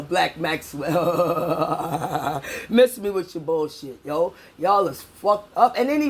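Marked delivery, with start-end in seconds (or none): 0.0-2.4 lower, very slow proud self-title; slow drawn out. Real, not acted. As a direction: drawl the name long and low.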